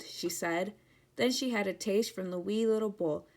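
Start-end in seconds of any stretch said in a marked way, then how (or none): none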